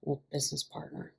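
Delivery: soft, casual murmur; quiet, easy reply. A narrator's reading. Mickey answering Ian quietly and easily. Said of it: "Mickey replied as quietly, but easily".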